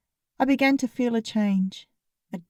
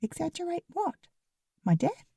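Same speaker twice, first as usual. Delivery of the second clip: incredulous; 7 semitones higher, 7 dB quieter than usual. A narrator's reading. Puzzling over his whispered word.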